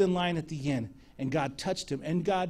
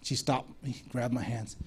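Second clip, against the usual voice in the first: normal talking voice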